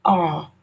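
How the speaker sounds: "R" as a long "ah" with the r silent; open, held non-rhotic "R"; British R sound. Shown as a demonstration. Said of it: "if you're using the British standard".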